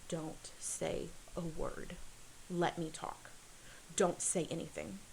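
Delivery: slow, hushed, a touch of urgency in a retold warning; hushed urgent warning. Older brother hushing the little one before the visit, hushed and urgent. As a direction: lightly imitate a brother pressing a secret order.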